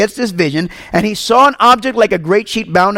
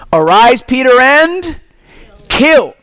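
raised, higher, booming command; commanding voice of God; 4 semitones higher, 4 dB louder, slower than usual. Acted. God commanding Peter from heaven, booming and raised. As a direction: call out the order to rise and kill.

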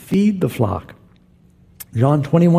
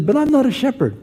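higher-pitched Israeli shepherd voice; Israeli accent; 12 semitones higher, faster than usual. Retold or imitated. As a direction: imitate an insistent Israeli man, pitched up. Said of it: "Israeli accent".

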